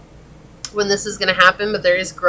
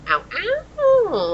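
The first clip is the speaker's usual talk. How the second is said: high squealed ow ow; playful catcall. Real, not acted. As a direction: tease with a catcall. Teasing catcall about the hots.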